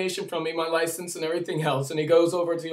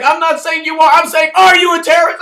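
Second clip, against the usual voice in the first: much higher, louder shouted retort; shouting cop; 11 semitones higher, 14 dB louder, faster than usual. Retold or imitated. Officer shouting his terrorist question again. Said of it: "I was like".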